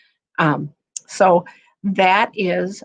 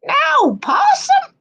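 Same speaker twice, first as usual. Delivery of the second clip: put-on much higher, slow old-lady question; Granny's surprise; slower than usual. Granny asks in surprise, much higher. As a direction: squeak it out in disbelief.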